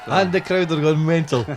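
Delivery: loud, high announcer's cheer; mock crowd hype. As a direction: shout it over an imagined roaring crowd. Host hails poem like commentator.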